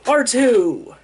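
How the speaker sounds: high, loud cry of surprise; excited exclaim. Retold or imitated. Narrator spotting the droid, excited exclaim. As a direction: call out the name, thrilled. R2 suddenly appears.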